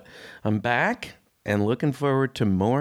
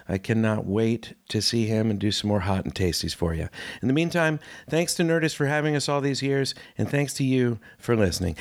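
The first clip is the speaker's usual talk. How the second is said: ordinary talk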